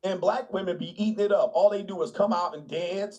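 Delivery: normal talking voice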